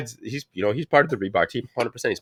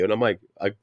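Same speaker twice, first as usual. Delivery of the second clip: normal talking voice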